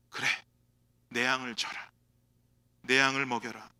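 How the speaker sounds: low, soft, gentle charge; tender command. Acted. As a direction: entrust it quietly and kindly. Lord's gentle commission. (낮고 부드럽게 맡기는 말씀)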